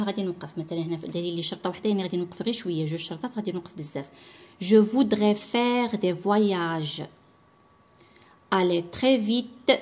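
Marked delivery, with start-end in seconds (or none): 4.6-9.7 slow, louder recitation pausing at each dash; poem read with pauses. Shown as a demonstration. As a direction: recite slowly, stopping where the dashes are. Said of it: "جو ريسبكت لي بوز". (إلقاء القصيدة ببطء مع احترام الوقفات)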